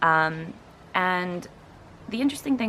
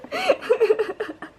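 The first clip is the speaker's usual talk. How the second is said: very high, loud laughter; helpless high-pitched laugh; real laughing fit; 8 dB louder than usual. Real, not acted. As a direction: laugh until the breath runs out. Reacts to bad accent clip.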